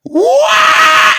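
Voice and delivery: very loud high scream. Pretend. Protester screaming to grab the crowd's attention. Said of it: "I'll scream".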